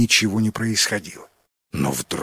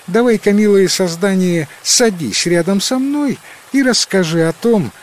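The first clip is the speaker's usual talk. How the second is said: высокий ласковый девичий голос; нежно утешить чудище; с сочувствием; 6 semitones higher than usual. A narrator's reading. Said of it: "с сочувствием". (сочувственный голос девушки)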